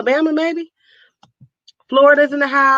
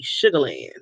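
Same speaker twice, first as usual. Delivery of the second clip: savoring the name; 12 semitones lower, 6 dB quieter than usual. Shown as a demonstration. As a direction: relish "Sugar Land" low and dreamy, like a happy place. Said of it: "something about saying Sugar Land".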